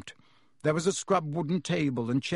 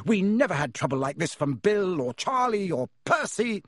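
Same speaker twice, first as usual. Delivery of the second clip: furious mother; 5 semitones higher than usual. A narrator's reading. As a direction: tear into the boys, furious mother. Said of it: "her rage broke over them".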